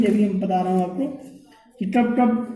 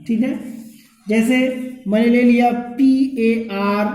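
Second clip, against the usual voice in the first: ordinary delivery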